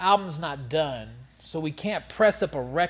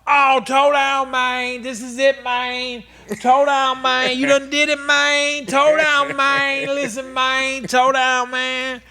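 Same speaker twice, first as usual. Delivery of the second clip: much higher and louder, slow excited country drawl; imitating Hawk; 10 semitones higher, 10 dB louder, slower than usual. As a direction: hype up Toe Down as Hawk, loud and high. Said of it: "and Hawk".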